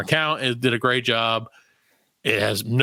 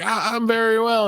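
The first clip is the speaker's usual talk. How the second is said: higher, stammering nerves; mock nervous reply; 9 semitones higher than usual. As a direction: stumble out a scared answer. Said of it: "I'm like".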